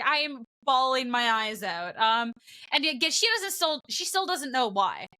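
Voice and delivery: ordinary talk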